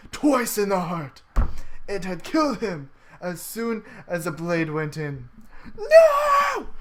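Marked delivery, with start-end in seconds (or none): 5.8-6.7 high, loud drawn-out "no" scream. Acted. Narrator's hero sees friend stabbed in the heart, screaming. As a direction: scream it out in full dramatic horror. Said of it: "I scream".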